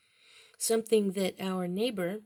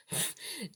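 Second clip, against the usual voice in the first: soft unvoiced laugh; 6 semitones higher, 8 dB quieter than usual. Real, not acted. Laughs at own slip.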